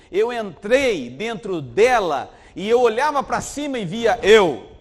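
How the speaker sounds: preacher voicing the woman's story, building; preaching at full force. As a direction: hammer every "eu" harder. Preacher voices the young woman.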